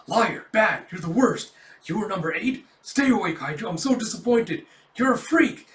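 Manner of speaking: hammed-up accusations; mock accusing friends. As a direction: fling insults like the imagined squad. Imagined accusing voices of squad.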